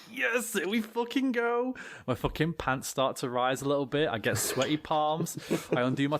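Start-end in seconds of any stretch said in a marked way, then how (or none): 0.1-1.8 excited. Retold or imitated. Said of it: "and I'm like".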